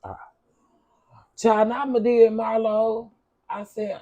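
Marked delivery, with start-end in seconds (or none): 1.4-3.1 excited. Real, not acted. Repeated excited reaction.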